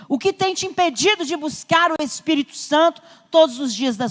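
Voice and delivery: insistent preacher driving each phrase home; forceful preaching. Real, not acted. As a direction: challenge the congregation with pressing questions. Exhorts congregation at full force.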